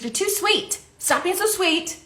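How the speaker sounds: high-pitched mock scolding; imitating blunt friend. Friend telling her she's too sweet, mock scolding. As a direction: tell her off in a high, playful voice. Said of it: "She's like".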